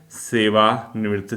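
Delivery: "सेवानिवृत्त" drawn out slowly in parts; slow dictation. Shown as a demonstration. Teacher repeating a term for students to write, slow dictation. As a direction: dictate "सेवानिवृत्त" slowly, separating "सेवा" from "निवृत्त".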